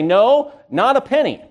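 a high, loud, firm no; emphatic denial. Retold or imitated. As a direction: deny it outright, proud. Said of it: "And you say".

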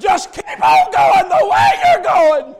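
high, loud revival preaching; full-throated, urgent sermon shout; preaching at full force. Real, not acted. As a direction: shout the warning at the sinner.

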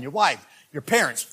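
ordinary talk, nothing marked

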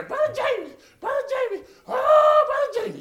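hollering a name from far away; calling out. Retold or imitated. Church lady yelling for the preacher across the camp field, high and loud.

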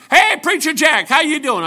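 hooker calling out. Retold or imitated. Old hooker greeting him, retold.

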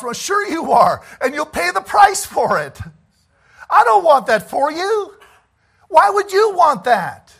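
loud, high, impassioned shout; preaching at full force. Real, not acted. Preacher to his flock, pleading in a loud high shout. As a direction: hammer it home to the congregation.

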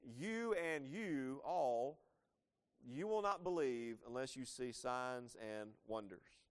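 slow, weighty rebuke to the crowd; addressing everyone. Retold or imitated. Jesus speaking to the Galileans, slow and solemn. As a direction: lay each word down slowly, as a warning to all. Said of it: "then he speaks ... to all the Galileans".

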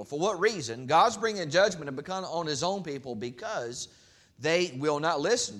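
loud, high impassioned preaching of the prophet's lament; preaching at full force. Acted. Preacher voicing Jeremiah's complaint to God, loud impassioned preaching. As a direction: cry it out from the pulpit, pleading with God.

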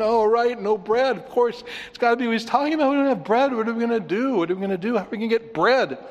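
high, loud and rushed hammed-up worry; mock fluster. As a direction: gabble like the panicking disciples. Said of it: "and they're going".

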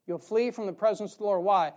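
ordinary talk, nothing marked

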